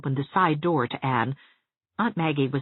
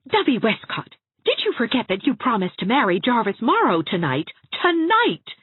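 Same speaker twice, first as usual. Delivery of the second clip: higher, incredulous scolding building to a loud peak; exasperated scolding; 9 semitones higher, 5 dB louder than usual. A narrator's reading. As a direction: scold her in exasperation, last word shooting up. Exasperated Anne scolds tearful Dovey.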